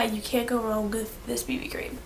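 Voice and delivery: ordinary talk, nothing marked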